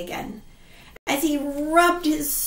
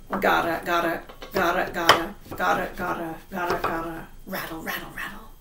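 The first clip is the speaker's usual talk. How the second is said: low rattling sound; 8 semitones lower, 4 dB quieter, much faster than usual. Acted. Coffin lid rattling.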